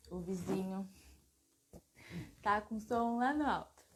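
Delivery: loud, projecting voice. Real, not acted. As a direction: speak up so the audience hears. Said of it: "falou um pouquinho mais alto".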